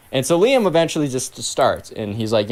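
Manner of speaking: ordinary talk, nothing marked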